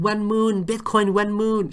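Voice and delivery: high, loud howl at the moon; mock drunken yelling. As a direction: play a hammered dog wailing skyward, loud and high. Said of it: "she was yelling at the moon".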